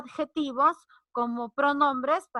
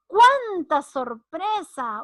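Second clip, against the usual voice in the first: higher, louder, drawn-out surprise; exclamación de sorpresa; 4 semitones higher, 5 dB louder, much slower than usual. Shown as a demonstration. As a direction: stretch it out in delighted surprise. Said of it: "Es exclamación". (exclamación alargada, aguda y fuerte)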